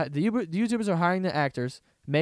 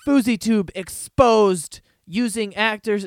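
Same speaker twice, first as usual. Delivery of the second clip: loud, slow hyped headline voice; mock YouTube headline, loud and drawn out; clickbait title read; 6 semitones higher, 8 dB louder, slower than usual. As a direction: announce it like a sensational video title.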